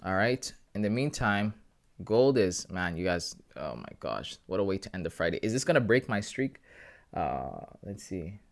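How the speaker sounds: usual speaking voice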